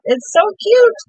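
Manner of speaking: high, delighted "so cute"; a bright burst of real delight; excited squeal. Real, not acted. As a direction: gush over the puffing paint. Crafter delighted by puffing paint.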